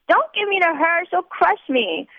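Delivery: high, panicky plea, slowing at the end; drunken fear. A narrator's reading. Drunk girl pleading with nuns not to hand her over, high mock panic. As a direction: beg not to be handed over, squeaky and scared. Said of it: "I am struck by otherworldly fear".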